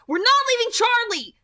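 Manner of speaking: high, loud defiant yell. A narrator's reading. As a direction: refuse at full volume. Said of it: "I yelled".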